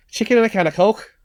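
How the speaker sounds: high-pitched Scouse catchphrase; Scouse accent. Shown as a demonstration. Host shows the phrase for getting into Scouse, high Scouse accent. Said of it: "to get into the Scouse accent".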